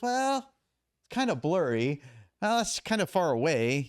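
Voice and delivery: mock the abuser's excuses; mocking evasive defendant.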